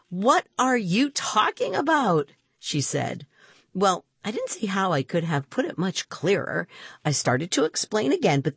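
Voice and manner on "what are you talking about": high-pitched baffled question; incredulous. Acted. Rima hears Jamie's wish story, incredulous. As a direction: question him in disbelief.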